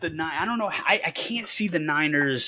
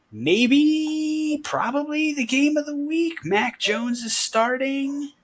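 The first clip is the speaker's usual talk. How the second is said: excited hype; 12 semitones higher, slower than usual. Real, not acted. Hyping the game of the week.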